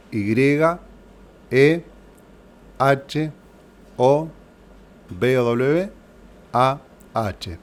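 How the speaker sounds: slow, spaced dictation of each letter. Shown as a demonstration. Teacher repeating the letters for students writing, slow and spaced. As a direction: spell it out with long pauses so they can write. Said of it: "así que lo repito".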